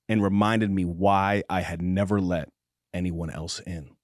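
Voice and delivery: slow, low romance narration; audiobook narration. Acted. Host reading a spicy romance passage aloud, measured narration. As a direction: read it like a slow, intimate audiobook.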